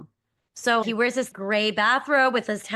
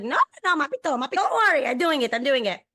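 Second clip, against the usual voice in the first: higher mock mother refusing help; imitating Israeli mom; 6 semitones higher, faster than usual. Mother refuses son's dish help in a higher mock voice. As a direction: play the stubborn mom, higher pitched. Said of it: "She's like".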